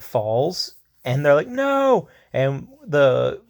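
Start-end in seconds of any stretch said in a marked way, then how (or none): 1.5-2.1 sudden high cry of dismay; shocked cry. Retold or imitated. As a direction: yelp it out in alarm, pitched way up. Friends see the rat trigger bomb.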